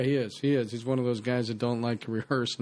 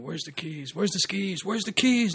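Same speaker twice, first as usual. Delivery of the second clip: building scream; 8 semitones higher, 5 dB louder than usual. Retold or imitated. Actor barging in, screaming for the keys, building up. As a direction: yell it louder and higher each time. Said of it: "I was just screaming".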